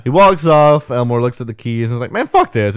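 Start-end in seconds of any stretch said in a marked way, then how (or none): 2.1-2.8 higher, exasperated mock curse; fed-up grumble. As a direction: throw up your hands at it. Elmore fed up with keys.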